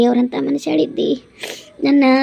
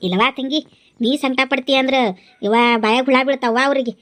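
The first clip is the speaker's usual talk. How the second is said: ordinary talk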